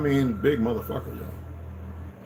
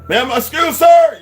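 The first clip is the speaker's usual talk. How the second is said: flustered shout; 11 dB louder, slower than usual. Retold or imitated. Flustered reply shouted to superior.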